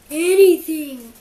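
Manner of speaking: a loud gasping plea, out of breath from tickling; wheezed. A narrator's reading. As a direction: gasp it out, desperate to make them stop. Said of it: "wheezed Tyrannosaurus Rex".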